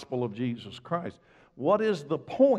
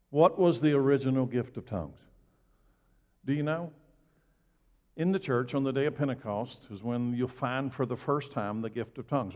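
normal talking voice

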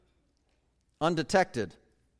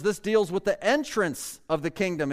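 usual speaking voice

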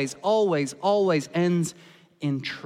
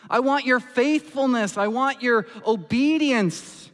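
high, loud, pleading cry; impassioned plea; 8 semitones higher, 5 dB louder than usual. Acted. God pleading with Saul for his heart, high impassioned cry. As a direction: let God's longing burst out high and loud.

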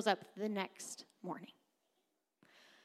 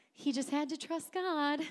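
higher, lightly sing-song mimicry; mock breezy admiration; 6 semitones higher than usual. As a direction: imitate an easy, knowing reaction. Mimicking readers who know the ending.

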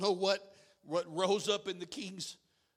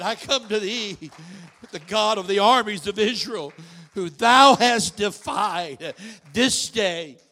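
loud, proclaiming King James speech; mock biblical prophet; 12 dB louder than usual. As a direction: declare it like a prophet, building. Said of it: "He said".

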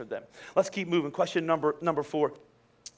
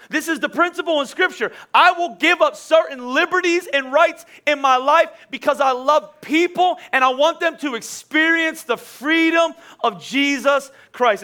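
higher, driving preacher delivery; impassioned preaching; 7 semitones higher, 5 dB louder, slower than usual. Real, not acted. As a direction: preach it emphatically, key words drawn out. Preaches at full force.

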